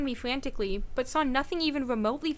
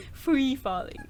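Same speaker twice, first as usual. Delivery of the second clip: laughing; 5 dB quieter, slower than usual. Real, not acted. Jokes while falling.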